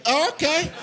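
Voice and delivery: high-pitched startled squeak; startled kid. Acted. Kid picked up by an angry dad, squeaky and startled. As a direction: squeak it out as if hoisted by the arm. Kid being yanked up by arm.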